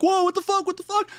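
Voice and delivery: imitating a high, shocked yell; mock high-pitched alarm at being shot at; startled yelling. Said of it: "they're all yelling at me".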